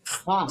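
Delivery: "ja" with long audible friction before the vowel; fricative demo. Shown as a demonstration. Teacher asking students to listen, drawn-out friction. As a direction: let air scrape through the J of "ja". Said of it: "Ja, hay una fricción".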